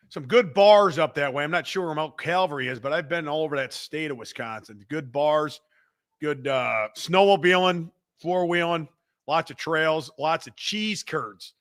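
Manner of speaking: ordinary talk, nothing marked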